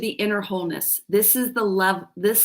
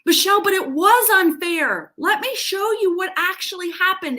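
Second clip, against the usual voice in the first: high, louder indignant objection from a listener; mock protest; 9 semitones higher, 4 dB louder than usual. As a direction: play the protesting viewer, pitched way up. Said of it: "who are gonna say to me".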